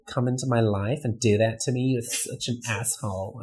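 put-on offended valley girl, trailing off softly; California valley girl. Shown as a demonstration. Man plays offended Californian on request, valley girl accent.

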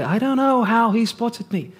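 ordinary talk

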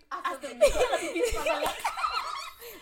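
high squealing laugh; shrieking laugh. Real, not acted.

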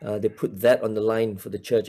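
ordinary delivery

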